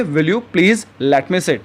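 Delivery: very slow, each word spaced out for learners; slow teaching model. Shown as a demonstration. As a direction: say it slowly and clearly like a teacher modeling a phrase. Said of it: "तो English में आप क्या कहेंगे".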